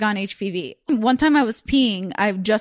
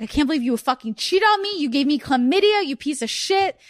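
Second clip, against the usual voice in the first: higher-pitched retold outrage; outraged; 5 semitones higher than usual. Leaving the cheating boyfriend a voicemail, retold outrage. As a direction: act out an angry voicemail, pitched up.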